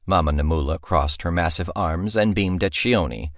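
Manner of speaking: ordinary delivery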